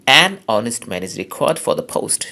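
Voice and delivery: "An" stressed, slow and full. Shown as a demonstration. Teacher demonstrating strong form, stressing "An". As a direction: slowly hit "An" as a strong vowel. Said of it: "জোর দেওয়া হলে".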